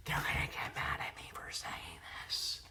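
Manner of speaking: furtive whispered aside. Real, not acted. Vlogger confessing a risky opinion about the cast, whispered. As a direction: whisper it so the others won't hear. Confides an opinion others may resent.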